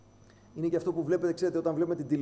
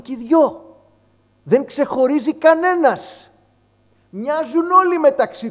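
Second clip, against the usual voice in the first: mock shouting; 7 semitones higher, 9 dB louder than usual. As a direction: raise the voice as if imitating the shouting panel. Describes people shouting on TV. (φωναχτά, σαν καβγάς σε τηλεοπτικό πάνελ)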